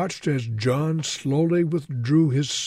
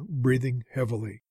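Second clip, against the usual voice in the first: ordinary delivery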